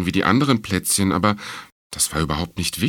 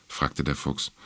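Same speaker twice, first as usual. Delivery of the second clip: usual speaking voice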